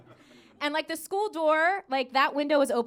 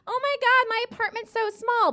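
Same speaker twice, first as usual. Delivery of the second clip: high-pitched mock complaint; whining Emily; 9 semitones higher, 4 dB louder than usual. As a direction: imitate a spoiled whine, pitched way up.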